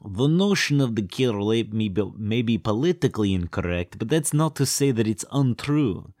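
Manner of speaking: muddled, hammed-up Romanian accent; mock foreign accent. Romanian defending the killer ape idea, wobbly mock accent. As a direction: put on an unsure Romanian accent. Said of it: "what I'm doing with that accent".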